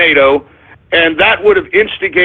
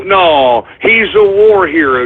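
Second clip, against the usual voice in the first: ordinary talk, nothing marked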